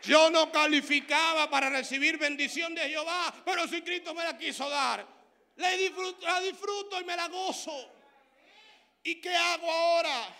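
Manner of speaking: loud, high preaching with joy; full-force preaching. Acted. Preacher rejoicing before the congregation, loud and high. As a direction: proclaim it joyfully.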